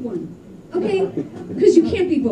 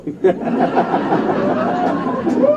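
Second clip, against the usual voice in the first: high laugh; 13 semitones higher, 4 dB louder than usual. Real, not acted. Laughing after the punchline.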